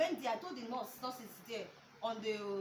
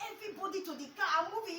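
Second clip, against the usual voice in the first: high, fast mock orders; imitated frantic hurry; 6 semitones higher, 3 dB louder, faster than usual. Husband hurrying everyone to the car, frantic imitation. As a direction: rattle it off high, like a husband in a panic.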